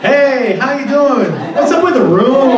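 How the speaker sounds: exaggerated high-pitched casual greeting; mock friendly dad. Dad greeting daughter before raising the room, mock friendly.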